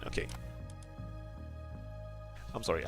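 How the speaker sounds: ordinary talk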